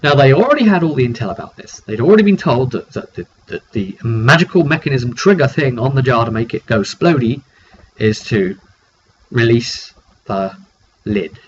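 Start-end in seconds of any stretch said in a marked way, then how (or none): none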